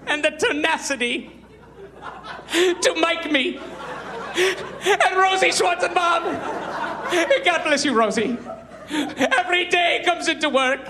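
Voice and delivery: a vain host breaking down and ranting his own greatness; mock sobbing Lipton. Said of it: "he starts crying".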